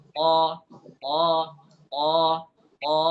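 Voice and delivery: a strong emphatic ta; heavy ta. Shown as a demonstration. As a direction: show the correct heavy letter. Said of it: "Ta itu harus tebal".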